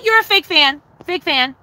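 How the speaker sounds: high chant of "fake fan"; mock chant at a chatter; taunting. Real, not acted. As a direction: tease him over and over in a high voice.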